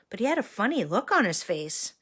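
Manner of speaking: plain reading voice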